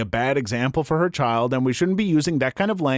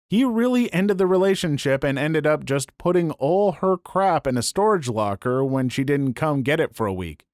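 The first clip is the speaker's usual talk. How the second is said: ordinary delivery, nothing marked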